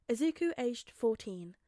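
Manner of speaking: ordinary delivery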